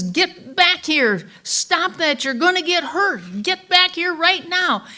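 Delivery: high, loud, urgent scolding; scolding mother. Retold or imitated. Mother calling her little boy back from danger, sharp high scolding. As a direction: order a straying child back, pitched high and forceful.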